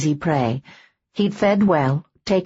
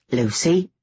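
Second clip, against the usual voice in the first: curt; 5 semitones lower than usual. Acted. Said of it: "he said shortly".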